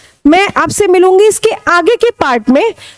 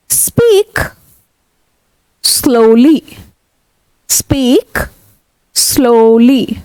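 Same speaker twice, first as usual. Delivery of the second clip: speak slowly; 3 semitones lower, much slower than usual. Shown as a demonstration. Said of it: "अब धीरे बोलो".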